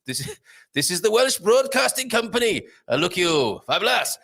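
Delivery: a laughing, hammed-up Welsh announcer accent. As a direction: play up a cartoon Welsh broadcaster. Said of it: "marks out of five for my Welsh accent".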